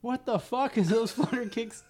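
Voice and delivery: high mock dressing-down; Dear God Actual. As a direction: chew out a recruit in imitation. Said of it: "Dear God Actual fucked up that private".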